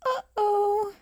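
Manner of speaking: high, small alarm; worried uh-oh. Acted. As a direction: realise the trouble. Realising trouble.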